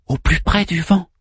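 high, short cue to an actor; prompting voice. A narrator's reading. Manmut prompts Orpheus with his next line, high cue. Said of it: "souffla Manmut".

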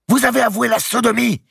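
very high, loud shout; furious accusation. A narrator's reading. Archbishop confronts the grand master publicly, furious accusation. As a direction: hurl the charge at him.

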